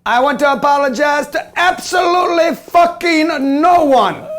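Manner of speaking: loud, slow Irish brawler shout; Conor McGregor impression. Imitating Conor McGregor's Irish accent, shouted loud and slow. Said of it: "You hang around Conor McGregor".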